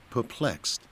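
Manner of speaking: usual speaking voice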